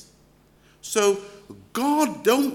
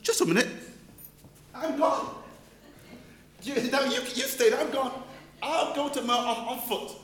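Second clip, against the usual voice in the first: panicked retreat; 5 semitones higher, 4 dB quieter than usual. Pretend. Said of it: "I'm going".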